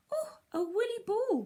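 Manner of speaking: put-on high, bright excited little girl's cry; delighted cry. A narrator's reading. Little girl finds a fluffy owlet, delighted cry. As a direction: squeal it like a delighted child. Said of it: "cried the little girl".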